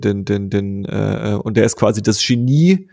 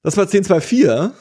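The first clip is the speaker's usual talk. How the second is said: higher, rising episode sign-off; undisguised sign-off; 8 semitones higher than usual. Real, not acted. Host signs off the episode, undisguised rising voice. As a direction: lift the pitch toward the end. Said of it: "Ich darf meine Stimme nicht verstellen".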